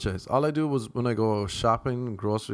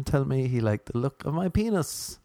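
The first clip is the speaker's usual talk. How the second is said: ordinary talk, nothing marked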